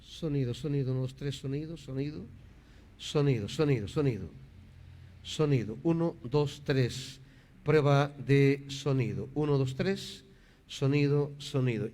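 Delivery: low, soft, measured mic check; prueba de sonido. Real, not acted. Prueba el micrófono tras un fallo. (prueba de micrófono, en voz baja y grave)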